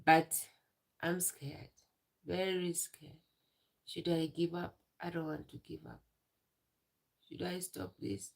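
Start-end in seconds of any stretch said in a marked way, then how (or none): none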